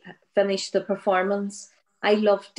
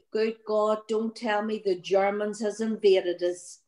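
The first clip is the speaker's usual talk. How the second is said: usual speaking voice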